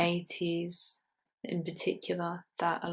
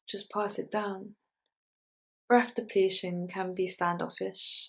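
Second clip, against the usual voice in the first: measured poetry recital, firmer than chat; poem reading; 5 dB louder than usual. Acted. Poet reading a pickling poem to an audience, measured recital. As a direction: read it out in deliberate phrases with pauses.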